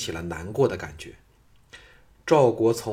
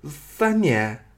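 higher-pitched shocked question; exclaim, taken aback; startled outcry; 4 semitones higher, 3 dB louder than usual. A narrator's reading. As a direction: blurt it out in disbelief. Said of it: "失声说".

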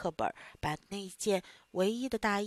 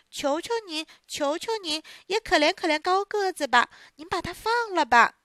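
higher, pleading voice; 8 semitones higher, 4 dB louder than usual. Acted. Pinocchio begging the boss to spare his friend, high and pleading. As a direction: beg the boss for mercy.

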